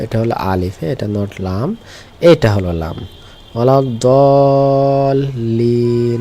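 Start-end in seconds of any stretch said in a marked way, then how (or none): 3.5-6.2 ওয়ালাদ-দাল্লিন with very long, level held vowels; slow recitation. Shown as a demonstration. Teacher reads the verse to find lam, reciting slowly.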